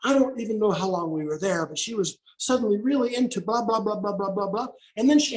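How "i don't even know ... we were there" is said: usual speaking voice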